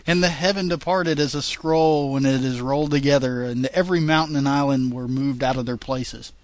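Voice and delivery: reading scripture aloud, steady and measured; King James reading. Acted. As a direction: read it like a Bible passage. Reads scripture.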